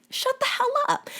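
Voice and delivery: high, thin voice snapping at him; high squeaky shut-up; squeaky mock scold. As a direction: squeak the rebuke like a cartoon. Repeats rebuke in squeaky voice.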